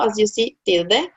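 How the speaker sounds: usual speaking voice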